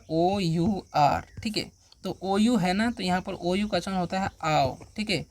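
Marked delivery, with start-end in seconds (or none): 0.1-1.2 letters spelled slowly one by one; slow letter-by-letter spelling. Shown as a demonstration. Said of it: "अब देखिए next word क्या है".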